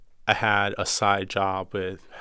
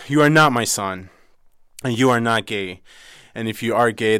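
louder, harsh rejection of the son; mother's stern rebuke; 2 semitones higher, 9 dB louder than usual. Retold or imitated. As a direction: lay down the law as a furious mother.

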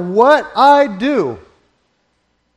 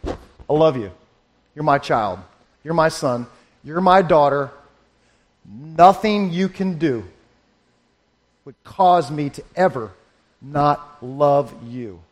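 tender voice of God; 6 semitones lower than usual. Pretend. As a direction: speak as a gentle father reassuring his child. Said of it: "He'd say".

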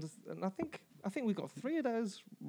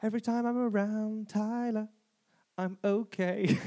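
ordinary talk, nothing marked

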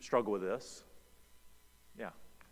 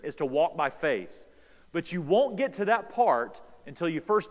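loud, driving sermon cadence; preaching at full force; 2 semitones higher, 6 dB louder than usual. Acted. Pastor preaching hard to his church, loud and driving.

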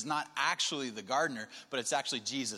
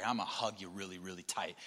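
low, softer acted parent; intense hushed relief; 7 semitones lower, 5 dB quieter than usual. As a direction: drop low, holding the child close. Father clutching found daughter.

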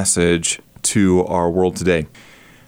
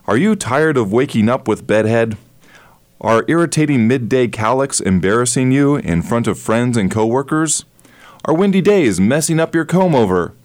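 infomercial host; 2 semitones higher than usual. Shown as a demonstration. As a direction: sell it like a late-night infomercial. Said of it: "using the infomercial style".

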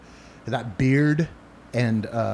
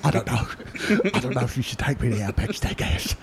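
Michael Caine impression. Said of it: "This is a sweet-ass Michael Caine".

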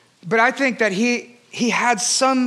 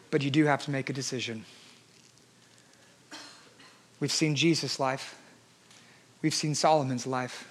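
much softer, lower, intimate; soft altar call; 9 semitones lower, 10 dB quieter than usual. Acted. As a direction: speak it close and gentle, like a final plea. Soft closing appeal.

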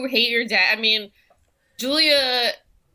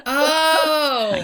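laughing; 8 semitones higher, 5 dB louder than usual. Real, not acted. Laughing at the slur joke.

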